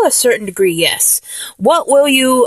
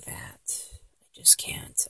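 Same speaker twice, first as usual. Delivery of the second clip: whisper; 21 dB quieter, slower than usual. Acted. Whispered aside about age.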